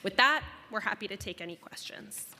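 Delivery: ordinary talk, nothing marked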